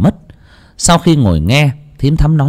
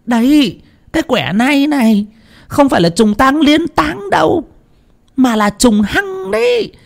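much higher, scolding, rising to a tearful shout; scolding howl. A narrator's reading. Fortune-teller scolds Thắm over the burial hour, high and alarmed. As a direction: berate her, voice climbing in alarm. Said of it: "thầy choáng váng hú lên một cái".

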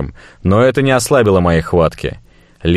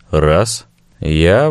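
ordinary delivery, nothing marked

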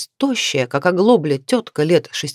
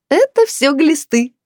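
high, slow, brassy announcement; brash declaration; 9 semitones higher, 3 dB louder, slower than usual. A narrator's reading. Neighbour jokes about her weight loss, brash declaration. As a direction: declare it shamelessly as a joke. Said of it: "заявила Люба и громко рассмеялась".

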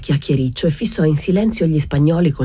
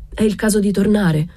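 frightened; 7 semitones higher than usual. A narrator's reading. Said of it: "domandò spaventato".

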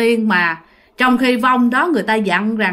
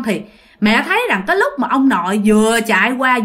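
ordinary delivery

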